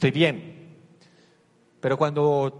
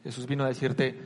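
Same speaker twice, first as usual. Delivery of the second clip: normal talking voice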